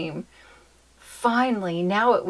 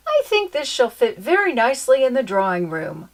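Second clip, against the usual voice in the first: suggesting; 5 semitones higher, 5 dB louder than usual. Acted. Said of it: "suggested Papa".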